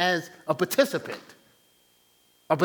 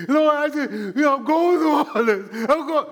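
mock crying; 8 semitones higher, 6 dB louder than usual. Preacher replaying his own tearful prayer, hammed up. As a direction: overact the sobbing complaint. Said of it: "So that was me".

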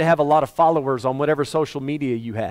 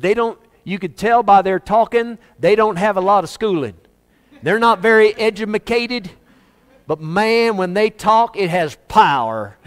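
uneducated American talk; 6 semitones higher, 5 dB louder than usual. Acted. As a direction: put on a loud, high-pitched backwoods American voice. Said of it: "that's a bit of American uneducated talk".

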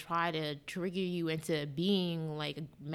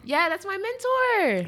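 much higher, louder, slow and drawn out; mock proud; 13 semitones higher, 11 dB louder, much slower than usual. Real, not acted. Bragging about her mentor, hammed-up pride. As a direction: gush it like a fan.

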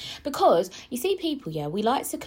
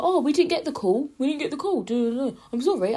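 higher-pitched imitation of an apologetic worker; mock staff apology; 7 semitones higher, 4 dB louder than usual. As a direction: reel off excuses in a raised voice. Acting out staff member's flustered apology.

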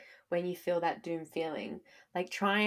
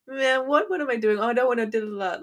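higher, louder self-questioning; exasperated; 7 semitones higher, 6 dB louder than usual. Pretend. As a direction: exclaim in mock dismay. Imagined self-talk in the past.

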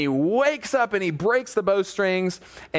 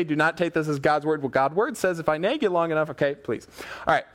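ordinary talk

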